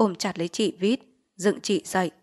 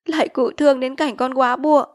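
a touch of tearful pleading; pleading widow; 6 semitones higher, 8 dB louder than usual. Acted. Widowed mother begging the chief for pity, pleading. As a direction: beg him for pity.

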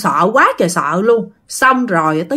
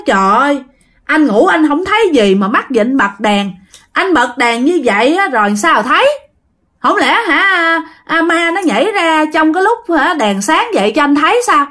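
exasperated scolding; 5 semitones higher than usual. Retold or imitated. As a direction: scold him in disbelief. Girl exasperated at her brother. (giọng cao, to, trách móc không tin nổi)

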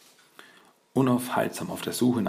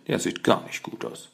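mock whiny concern; fake tearful. A narrator's reading. Leonardo remarking on the beaten Shredder, fake tearful. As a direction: put on a slightly weepy voice. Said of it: "mit gekünstelt weinerlicher Stimme".

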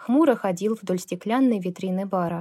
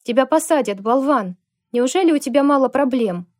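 scolding yell; 5 semitones higher, 6 dB louder than usual. Acted. She yells at Lukas.

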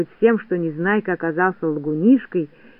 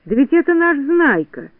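high, amazed exclamation; raised pitch, thrilled discovery; excited cry; 7 semitones higher than usual. A narrator's reading. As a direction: cry out in glad recognition. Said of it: "закричал доктор Пилюлькин".

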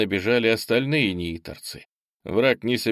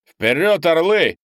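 loud, high rallying shout; battle cry; 8 semitones higher, 7 dB louder than usual. A narrator's reading. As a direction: shout your men into the charge. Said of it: "крикнул землянин". (боевой клич, громкий крик)

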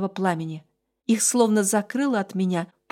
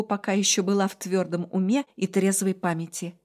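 ordinary delivery

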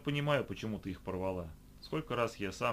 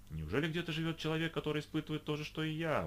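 surprised; 8 semitones higher than usual. A narrator's reading. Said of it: "удивился Роланд".

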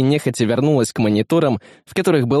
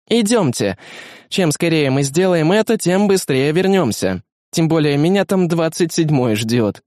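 higher-pitched female character voice; brisk Beta urging them on; 4 semitones higher than usual. Acted. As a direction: lift the pitch and get the men moving. (Бета торопит, высоким голосом)

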